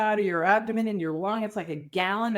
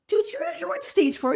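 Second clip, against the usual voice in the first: much higher, louder disbelief; exasperated protest at the partner; incredulous retort; 4 dB louder than usual. Retold or imitated. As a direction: push back in disbelief. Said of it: "So I'm like".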